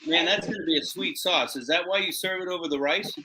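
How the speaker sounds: ordinary delivery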